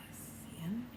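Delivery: soft unvoiced whisper. Real, not acted. Teacher whispering to children about the crocodile, soft whisper. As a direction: hush as if not to wake him.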